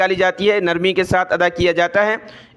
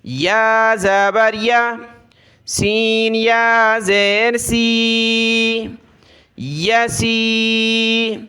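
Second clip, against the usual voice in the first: measured sing-song letter-by-letter recitation; slow classroom recitation drill; Qaida spelling drill; 3 semitones higher, much slower than usual. Shown as a demonstration. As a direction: chant each syllable, then blend and stretch the word.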